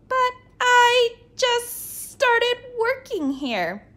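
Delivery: high, slow whining protest; teacher pleading with the librarian; whiny plea. Acted. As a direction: whine your excuse.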